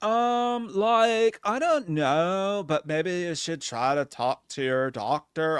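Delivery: put-on drawn-out hesitant girl, turning unsure; mock girl voice. Friend imitating the girl AI dodging a serious question. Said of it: "she's like".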